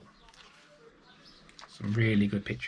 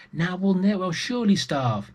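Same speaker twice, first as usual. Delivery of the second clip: despairing cry; 8 semitones higher, 3 dB louder than usual. Acted. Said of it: "she cried".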